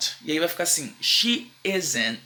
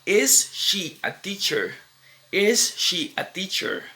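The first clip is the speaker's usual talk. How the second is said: slow question model; 3 semitones higher, slower than usual. Shown as a demonstration. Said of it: "Eu vou falar".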